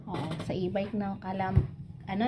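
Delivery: very soft, hushed; whispering while hiding. Real, not acted. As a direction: hush it like a secret. Hushed secret plan. (pabulong, nagtatago)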